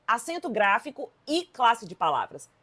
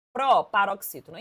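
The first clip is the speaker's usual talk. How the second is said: ordinary talk, nothing marked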